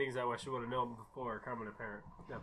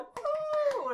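excited ooh; 4 dB louder than usual. Retold or imitated. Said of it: "It's like".

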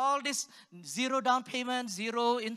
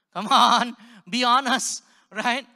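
loud appeal; 2 semitones higher, 13 dB louder, slower than usual. Real, not acted. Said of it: "calling out to the audience".

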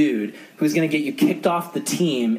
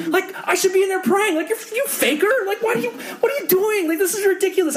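high-pitched, exasperated mock rant; frustrated outburst. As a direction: scold the faker in comic indignation. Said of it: "I was so frustrated at him".

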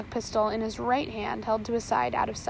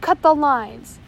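full loud bellow, even harder; louder bellowed order; 4 semitones higher, 10 dB louder, slower than usual. A narrator's reading. First mate repeating his order to the crew, louder bellow. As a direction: roar the order again, louder. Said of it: "he bellowed".

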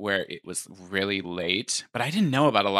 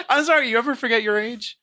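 laughing; 6 dB louder than usual. Real, not acted. Joking about forgetting age.

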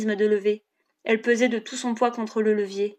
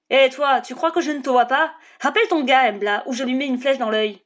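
raised voice, guard warning someone off; called-out threat; 3 semitones higher, 4 dB louder than usual. A narrator's reading. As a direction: call out a sharp threat to an intruder.

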